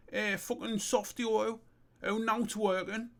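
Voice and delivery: high-pitched character chant; acted incantation. Character trying out commands on his mate's problem, high incantation. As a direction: call out each command high, in short bursts.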